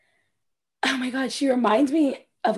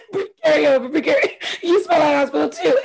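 louder mock quarrel, breaking into laughter; laughing re-enactment; 5 semitones higher, 4 dB louder than usual. Co-host re-enacting the Carrie scene, laughing mock outburst. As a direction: imitate the housewife while cracking up.

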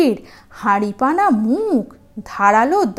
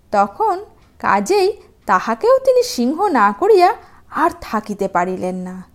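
ordinary delivery

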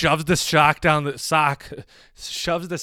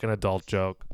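ordinary talk, nothing marked

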